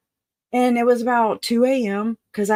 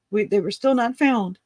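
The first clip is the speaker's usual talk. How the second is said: ordinary delivery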